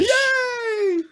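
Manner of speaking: high, laughing yay; excited cheer. Real, not acted. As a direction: squeal it with delight. Cheering a guest's arrival.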